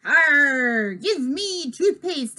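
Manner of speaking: loud growly arr, drawn-out demand; hammy pirate demand; pirate yell. A narrator's reading. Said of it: "I yell at Luke and Violet".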